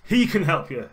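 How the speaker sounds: high, loud, insistent zealot; too-loud voice. Acted. Stranger pressing someone in the street, too loud. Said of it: "getting a little bit louder than it needs to be".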